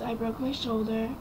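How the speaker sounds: ordinary talk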